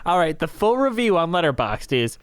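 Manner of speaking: usual speaking voice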